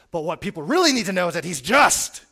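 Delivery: loud, hammering the point home; mock angry preacher. Preacher quoted answering a question about grace, loud and insistent. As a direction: play the yelling preacher insisting on justice.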